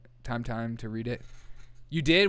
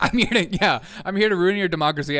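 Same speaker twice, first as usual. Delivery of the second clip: louder, faster mock announcement of the plan; mock clueless visitor; 4 semitones higher, 6 dB louder than usual. The teen driver proudly telling the guards his plan, loud mock voice. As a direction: blurt the plan out like proud news.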